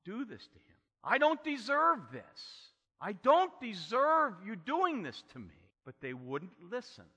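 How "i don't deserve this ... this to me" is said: high, loud anguished plea, slow at first; pleading for his life. Retold or imitated. Joseph in the pit pleading with his brothers, anguished loud plea. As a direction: beg for mercy with everything you have. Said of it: "He's pleading for his life".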